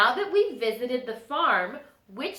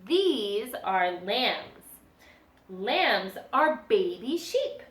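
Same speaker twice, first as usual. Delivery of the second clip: ordinary delivery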